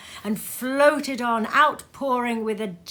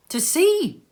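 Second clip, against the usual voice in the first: much higher and louder, astonished; surprised cry; 11 semitones higher, 8 dB louder than usual. A narrator's reading. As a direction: exclaim it in wonder. Surprised exclamation after not to sea.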